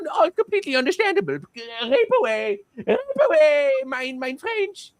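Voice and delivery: high, grovelling put-on German voice; hammed-up German pushover, eager to appease; servile mock Germans. Germans giving in to Syria's refusal, high servile mock voice. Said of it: "And they're like".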